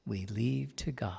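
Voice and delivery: hushed, slow whisper; slow reverent whisper. Real, not acted. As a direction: breathe it out softly to God. Prayerful close of a thought.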